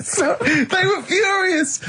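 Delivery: high, slow words through laughter; giggling through the line; laughing. Real, not acted. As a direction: barely get it out for laughing. Laughing at monkey surgeon.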